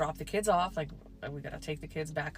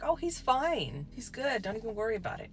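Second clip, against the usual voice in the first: mock-casual Karen, no big deal; breezy nonchalance; 5 semitones higher than usual. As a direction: brush it off like it's nothing. Karen brushing off the emergency.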